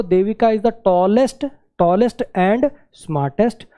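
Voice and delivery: very slow option read; reading option one. Shown as a demonstration. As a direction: read the first option slowly, word by word. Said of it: "एक तो".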